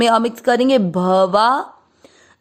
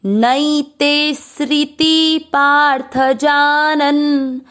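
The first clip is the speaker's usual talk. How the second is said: slow verse recitation; 3 semitones higher, much slower than usual. Shown as a demonstration. Teacher reciting the Gita line slowly for students to follow. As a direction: chant each syllable of the verse slowly and evenly. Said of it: "पहला और दूसरा चरण साथ में".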